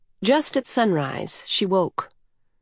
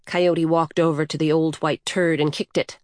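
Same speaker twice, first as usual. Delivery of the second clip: ordinary delivery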